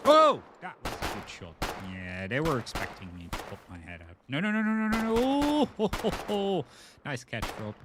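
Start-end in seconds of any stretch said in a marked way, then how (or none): 0.0-0.4 loud sudden gasp of surprise; startled yelp. Real, not acted. Hit by a shot in game. 4.3-5.1 loud, high panicked protest; panicked no's. Real, not acted. Panics under fire in game. 5.2-6.6 loud high whoop of relief; excited relief. Real, not acted. Saved at the last moment.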